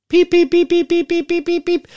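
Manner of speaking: very high, loud electronic beeping; bite alarm beeps. Retold or imitated. Telling viewers how the bite alarm sounded, imitated beeps. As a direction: mimic the bite alarm going off. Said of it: "Bis Anzeiger".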